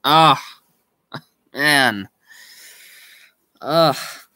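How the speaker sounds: ordinary talk, nothing marked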